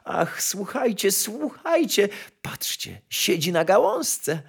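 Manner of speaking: put-on high, hushed girlish wonder; joyful. A narrator's reading. Kitchen girl pointing out the bird to courtiers, joyful. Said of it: "zawołała z radością dziewczynka".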